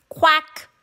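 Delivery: high quacking; duck quack. A narrator's reading. Duck greeting the truck, quacking. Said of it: "said a duck".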